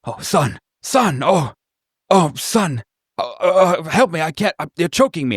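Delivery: choking father begging his son; desperate plea. Acted. Bound father being choked, begging son for help. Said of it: "he says".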